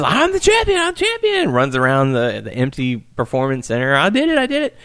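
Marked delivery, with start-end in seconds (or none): 0.0-1.5 high, squealing flamboyant champion cry; flamboyant Asuka. Retold or imitated. Asuka celebrating her surprise title win, high flamboyant squeal. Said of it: "in the flamboyant way that she does". 3.9-4.8 high giddy victory squeal; flamboyant Asuka. Retold or imitated. Asuka running round the empty arena, high giddy cheer. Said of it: "in the flamboyant way that she does".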